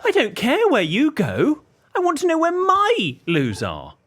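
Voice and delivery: high, loud, slow, spelled-out frustration; exasperated retort. Retold or imitated. Customer exasperated at staff, high and slow. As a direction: drag every word out, fed up. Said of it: "She said".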